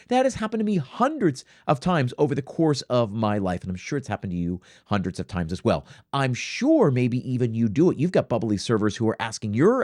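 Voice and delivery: ordinary talk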